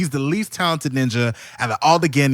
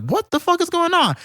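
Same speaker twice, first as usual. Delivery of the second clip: much higher, shocked disbelief; baffled Gaara. Retold or imitated. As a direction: squeak it out in total confusion. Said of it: "Gar's looking like".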